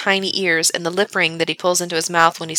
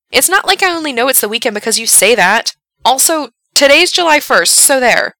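petulant, a bit louder; childish retort; 6 semitones higher, 5 dB louder than usual. Acted. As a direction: snap back like a sulky kid. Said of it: "he sounds like a six-year-old".

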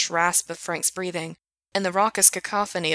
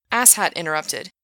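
ordinary delivery, nothing marked

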